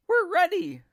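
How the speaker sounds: very high, loud chirpy reply; eager birds. A narrator's reading. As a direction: squeak it like a flock of birds. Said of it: "said the birds".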